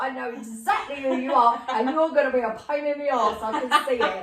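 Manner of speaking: loud, emphatic retold realisation; comically resigned to a troublemaker; mock exasperation. Retold exasperated recognition of troublesome spirit.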